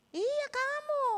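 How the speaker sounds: very high, drawn-out answer; emphatic confirmation. Retold or imitated. Teacher invites surprised pupil to lunch, emphatic confirmation. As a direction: re-enact an insistent, singsong reply.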